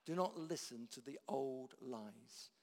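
ordinary delivery

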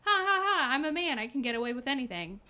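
hammed-up smug male chauvinist; seventies douchebag. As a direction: gloat with a fake laugh. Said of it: "he's just a douchebag in the seventies".

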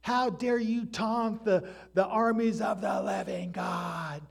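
hammed-up fierce challenge; David's retort. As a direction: hurl it back at the giant. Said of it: "and he's like".

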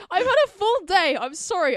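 loud, stretched words mid-yawn. Real, not acted. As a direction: talk through the end of a yawn. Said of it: "I yawned".

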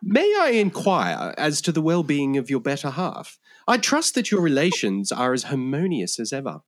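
put-on English accent. Translator politely rendering question about the wife, English accent. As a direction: inquire with very proper English diction. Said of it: "speaking in my genuine English accent".

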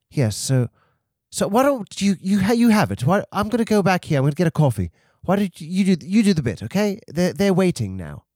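high, hurried British character voice; British accent. Acted. [name] passes the bit back to the host, British accent. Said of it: "I have this British accent".

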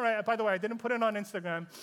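usual speaking voice

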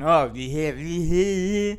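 overly emphatic fake French accent; mock French accent. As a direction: exaggerate a classmate's thick French accent. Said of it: "overly emphatic about, doing the, the French accent".

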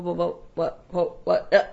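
soft, dazed repetition; stunned stammer. Retold or imitated. Performer reacting to news about friends, stunned stammer. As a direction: stumble over the word in mock shock.

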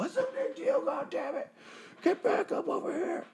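high, strained hushed scream; mock screaming. Shane yells to the other cops for help, hushed mock scream. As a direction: shriek it at half volume so nobody wakes. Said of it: "He's just screaming to the other guys".

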